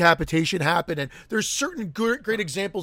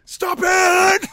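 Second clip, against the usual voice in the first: loud, high imitation shout; mock yell; 12 semitones higher, 6 dB louder than usual. Co-host echoes the quoted outburst, high mock shout.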